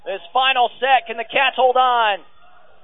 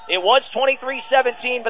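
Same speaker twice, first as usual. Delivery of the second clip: normal talking voice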